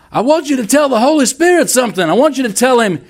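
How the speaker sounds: high, fast, fired-up preacher voice; rally the crowd like a showy revival preacher; flamboyant evangelist. Retold or imitated. Evangelist urging the congregation to respond, high and fast. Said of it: "the evangelist says".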